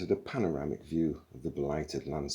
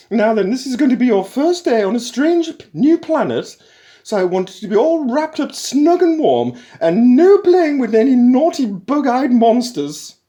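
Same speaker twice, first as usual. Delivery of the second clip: much higher, much louder, bright motherly call; matriarchal computer; 17 dB louder than usual. A narrator's reading. Ship computer greeting the crew, loud high matriarchal call. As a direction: greet them like a bossy mother. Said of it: "continued Eddie in his new voice".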